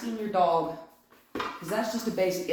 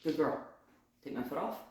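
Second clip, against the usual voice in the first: normal talking voice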